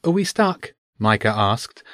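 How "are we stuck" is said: uncertain. A narrator's reading. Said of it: "a touch of uncertainty in his voice".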